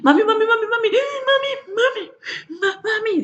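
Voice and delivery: put-on high, gasping child crying mami; child choking for air as he calls mami; breathless child calling. Speech therapist imitates a child running out of breath calling mom.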